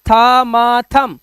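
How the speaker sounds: thumb sounded out "tha, ma, tham"; broken-down pronunciation. Shown as a demonstration. Teacher tells students to say only thumb as "tham", b silent, sounded out. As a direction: build thumb up to a closed m. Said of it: "तो आपको बोलना है सिर्फ".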